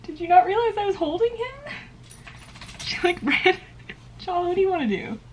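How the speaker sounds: high amused questions to the cat; pet talk. Real, not acted. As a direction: tease the cat lightly.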